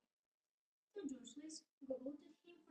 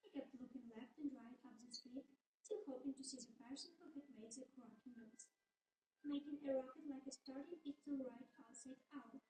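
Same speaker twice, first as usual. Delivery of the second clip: ordinary delivery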